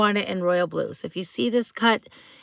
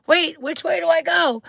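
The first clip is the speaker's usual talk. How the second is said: higher, mock-confused quote; lightly acted sitcom bewilderment; panicked-confused Ross; 7 semitones higher, 4 dB louder than usual. Retelling Ross's tanning-booth mix-up, mock confused. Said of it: "he's like".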